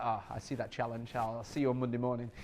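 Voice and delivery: hushed, low send-up of a teammate; mocking Marley. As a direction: lightly imitate a smug colleague accepting a challenge. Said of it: "Marley's like".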